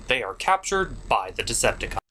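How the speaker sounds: normal talking voice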